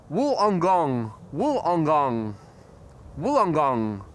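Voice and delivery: Wollongong said slowly three times, falling, WOOL-on-gong; practising a place name a viewer taught; corrected pronunciation. Shown as a demonstration. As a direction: carefully repeat Wollongong after the instruction. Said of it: "I hope that was better".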